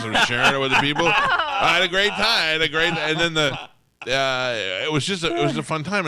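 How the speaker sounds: can't contain the thrill of it; excited gushing. Real, not acted. Telling friends about meeting Eddie, bursting with excitement.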